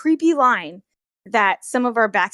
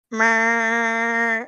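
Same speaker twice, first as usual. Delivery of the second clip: glitchy meow. Retold or imitated. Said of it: "he would just go".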